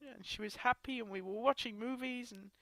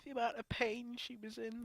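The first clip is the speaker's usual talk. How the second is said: usual speaking voice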